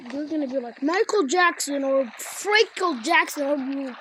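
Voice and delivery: normal talking voice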